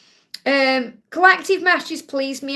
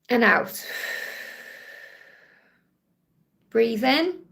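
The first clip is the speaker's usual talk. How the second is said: calm breathing instructor leading viewers; guided breathing; 2 semitones lower, slower than usual. Acted. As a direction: coach them gently through each breath. Leads a breathing exercise.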